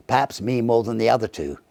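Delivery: ordinary delivery, nothing marked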